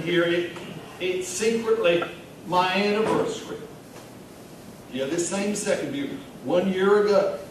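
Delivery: pet-store manager confiding in a new pal; Vernon Ricketts. Acted. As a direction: play a chatty barfly telling his own story. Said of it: "read it in the voice of the character".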